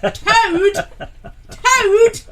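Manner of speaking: very high, loud, incredulous squawk; mock bafflement. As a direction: squeal the misheard word as a comic question. Acting out baffled reaction to mishearing.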